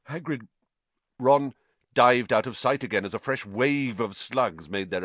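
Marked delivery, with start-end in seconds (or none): none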